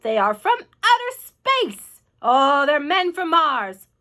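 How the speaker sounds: loud, high, drawn-out exclamation; amazed onlooker calling out loudly; crowd shouting. A narrator's reading. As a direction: shout it slowly, stretching the words. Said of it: "they shouted".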